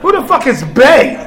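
loud, high demand; furious dad demanding; angry outburst. Retold or imitated. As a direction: bark it at the phone. Father reading daughter's phone.

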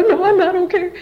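high, loud mock distress; fake crying. Daughter answering mother, high put-on tearful voice. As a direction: wail it as if upset.

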